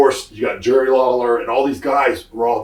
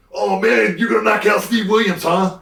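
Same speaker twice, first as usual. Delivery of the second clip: much higher teasing voice; mimicking Pat Patterson; 9 semitones higher than usual. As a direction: needle him in a much higher voice. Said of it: "Pat Patterson comes up to me".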